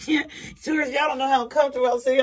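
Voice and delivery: loud, high, fast laughing confession; cracking up, high-pitched and rushed; laughing outburst. Real, not acted. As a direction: shout it through a real fit of laughter. Breaks up laughing, confides to viewers.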